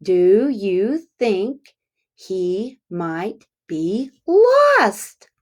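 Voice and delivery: very slow, drawn-out question; slow sing-along teacher pace; slow choral repeat. Shown as a demonstration. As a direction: lead the class word by word. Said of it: "Let's say that together".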